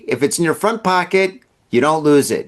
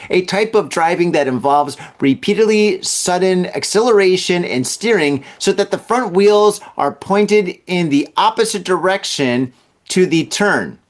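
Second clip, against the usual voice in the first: usual speaking voice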